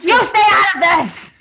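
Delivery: high, loud mock snap; hammed-up furious rebuff; angry warning. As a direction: imitate him yelling at a bystander. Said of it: "he's like".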